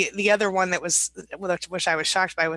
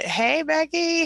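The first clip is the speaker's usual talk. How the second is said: excited greeting; 10 semitones higher, 5 dB louder, much slower than usual. Real, not acted. Cheerful greeting to a viewer.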